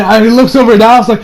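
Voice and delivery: ordinary talk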